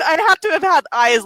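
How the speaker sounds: ordinary talk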